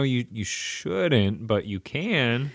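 high, slow, teasing allowance; sly permission. Pretend. Friend jokingly permitting the recording, slow and coy. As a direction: draw it out with a playful loophole.

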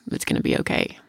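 barely voiced, whispering it gently; a soft whispered it's gonna be okay; whispered reassurance. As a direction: hush the child, trailing to a whisper. Comforting her sobbing daughter, fading.

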